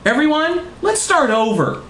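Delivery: high-pitched calling to everyone; hammed-up desperate appeal; desperate plea. A narrator's reading. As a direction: plead high, falling at the end. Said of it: "Margaret was getting desperate".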